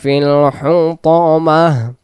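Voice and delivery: slow, drawn-out chanted recitation; Quran recitation. Shown as a demonstration. Teacher reciting the verse for students, slow chant. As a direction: chant each word with long held vowels.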